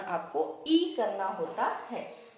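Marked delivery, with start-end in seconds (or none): 0.7-0.9 ई as a stressed, held "ee"; demonstrated long vowel. Shown as a demonstration. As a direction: mark ई out from the sentence. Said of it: "इन व्यंजनों का उच्चारण". (ई को ज़ोर देकर खींचें)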